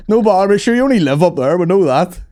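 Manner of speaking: loud, high, overly friendly; chummy cop. Retold or imitated. Cop letting drunk mate off, loud high chumminess. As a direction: wave it away with hearty enthusiasm.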